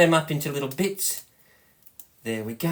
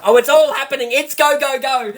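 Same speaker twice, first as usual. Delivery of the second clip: high, loud burst of real excitement; excited; 7 semitones higher, 4 dB louder than usual. Real, not acted. Cook cheering on her bubbling dessert, excited. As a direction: cheer it on as it happens. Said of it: "but I'm so excited".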